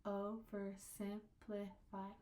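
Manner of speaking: slow, "Oversimplify" in five separate syllables. Shown as a demonstration. Teacher showing five syllables, slow and separated. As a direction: break "Oversimplify" evenly into its syllables. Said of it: "There should be five".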